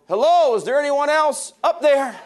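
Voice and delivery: high, loud comic yell upward. Retold or imitated. Man refuses God's answer, yelling loud and high. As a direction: holler up to the sky for someone else. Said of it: "Then he yelled".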